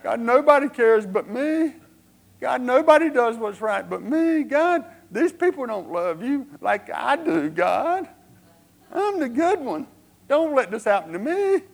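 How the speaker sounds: loud, high-pitched begging whine; hammed-up whiny prayer, high and loud; Elijah. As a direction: wail and beg like a self-pitying martyr. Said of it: "began to kind of whine and, and beg like that".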